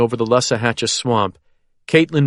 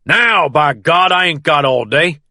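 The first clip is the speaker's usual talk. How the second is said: angry shout; 5 semitones higher, 4 dB louder than usual. A narrator's reading. Sheriff continuing the shouted order.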